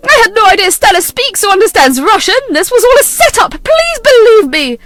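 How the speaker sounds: high, loud frantic plea, slowing at the end; desperate pleading. Acted. Mother pleading with her son, high and desperate. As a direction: beg him to believe you.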